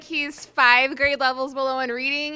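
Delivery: loud, projected group report; speaking up over others. Real, not acted. Group speaker reports on a student's reading, speaking up over others. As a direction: push it out over the laughter and chatter. Pressing on over laughter and crosstalk.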